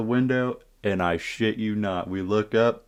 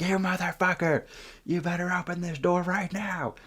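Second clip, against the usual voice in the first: imitated screaming Karen; 10 semitones higher than usual. Karen demands the boys open up, mock screaming. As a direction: put on a high irate mom voice. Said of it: "the Karen was screaming".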